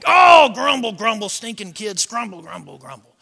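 mock-exasperated burst fading into grumbles; hammed-up grouchy parent; grumpy grumbling. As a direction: start loud, sink away grumbling. Acted grumbling at toys and kids.

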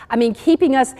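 usual speaking voice

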